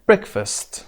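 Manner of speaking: "breakfast" with a high first syllable, falling after; teacher's model "breakfast", BREAK stressed. Shown as a demonstration. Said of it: "قسمت اول روی break هست".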